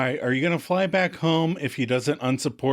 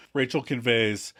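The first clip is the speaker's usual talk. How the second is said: normal talking voice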